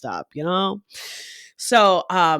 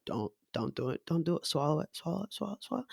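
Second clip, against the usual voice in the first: hushed, urgent muttering to oneself; self-restraint; 11 dB quieter, much faster than usual. Acted. Mimic tells self to hold back the accent, hushed mutter. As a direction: repeat it under your breath, softly. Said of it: "I'm like".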